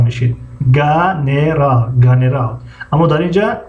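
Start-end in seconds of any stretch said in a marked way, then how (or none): 0.7-1.9 "گنرال" slowly as ga-ne-ral with hard g; hard G, syllables apart. Shown as a demonstration. Teacher showing what another vowel would give, hard G. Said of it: "ای نمی آمد". 2.0-2.6 "گنرال" run together as ganeral, hard g. Shown as a demonstration. Teacher repeating the hypothetical reading, hard G. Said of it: "ای نمی آمد".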